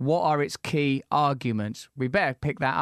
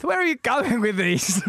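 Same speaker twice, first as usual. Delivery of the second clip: higher, slow call-out to the producer; pitched-up, incredulous complaint; mock exasperation; 9 semitones higher, 4 dB louder than usual. Real, not acted. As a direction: draw it out in comic disbelief. Scolds producer over the questions.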